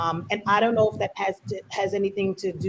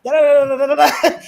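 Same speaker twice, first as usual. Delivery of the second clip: louder, higher rapid Caribbean chatter; flipping into accent; 6 semitones higher, 9 dB louder, faster than usual. Retold or imitated. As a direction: switch into full home accent with mom. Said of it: "And then you're like".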